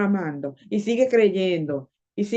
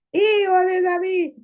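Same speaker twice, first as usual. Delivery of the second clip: high, insistent plea; acted shout for mercy; 9 semitones higher than usual. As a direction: cry out higher, refusing to be silenced. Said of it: "pero él gritaba más y más".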